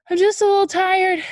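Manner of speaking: put-on high, loud kid voice making an excuse. A narrator's reading. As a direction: brightly cover up the fear. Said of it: "said Jabari".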